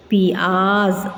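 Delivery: पियाज stretched slowly, each sound drawn out; spelled out slowly. Shown as a demonstration. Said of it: "आ की sound आएगी तो अलिफ लगाएंगे". (पियाज को धीरे-धीरे खींचकर बोलें)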